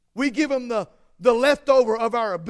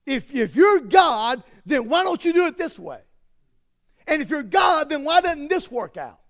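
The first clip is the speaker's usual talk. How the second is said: louder, demanding questions building up; mock indignant challenge; 3 semitones higher, 4 dB louder than usual. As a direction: rail at God like a frustrated complainer. Mock people challenging God loudly.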